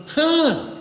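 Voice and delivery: squeezed nasal grunt; nasal huh. Shown as a demonstration. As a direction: push it through the nose. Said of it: "if you're in your nose and you go".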